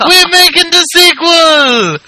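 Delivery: high, slow, booming hype voice; big excited sequel pitch; hyped announcer. Pretend. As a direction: sell it like a movie trailer.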